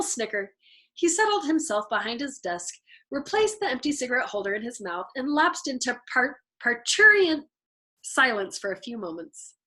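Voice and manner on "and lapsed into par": plain reading voice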